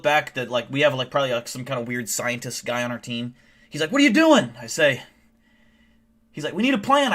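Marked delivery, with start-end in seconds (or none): 3.9-4.5 high, loud panicked question; alarmed scientist. Acted. Weird scientist questioning the hero, alarmed. 6.5-7.1 high, loud worried protest; alarmed scientist. Acted. Scientist to the hero about to jump, alarmed.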